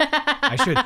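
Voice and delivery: said through a laugh; giggling sheepish agreement. Real, not acted. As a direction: laugh while admitting it.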